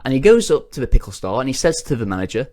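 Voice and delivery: usual speaking voice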